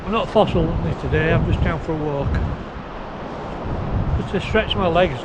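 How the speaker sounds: ordinary delivery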